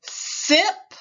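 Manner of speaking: teacher drawing out the s in "Sip,"; stretched hissing s. Shown as a demonstration. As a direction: hiss the s of "Sip," before the vowel. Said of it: "Repeat after me".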